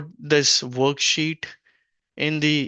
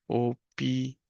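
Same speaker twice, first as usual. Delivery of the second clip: syllable-by-syllable dictation; 4 dB quieter than usual. Shown as a demonstration. Teacher writing the masculine noun for learners, syllable-by-syllable dictation. Word broken up while writing.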